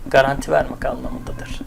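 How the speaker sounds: soft whispered definition. Shown as a demonstration. Teacher whispering the meaning of assure to the class, hushed whisper.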